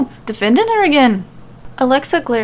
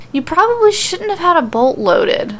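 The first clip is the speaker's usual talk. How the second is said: condescending; 8 semitones higher than usual. A narrator's reading. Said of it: "Alexa says condescendingly and with a cringe".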